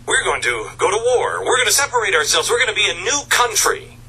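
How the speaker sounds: high-pitched, eager rallying cry; excited. Acted. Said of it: "The country was excited in July".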